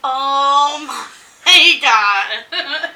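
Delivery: loud laughing exclamation; cracking up at the joke; laughing oh my god. Retold or imitated. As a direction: burst out laughing mid-phrase. Shocked at the guy's line.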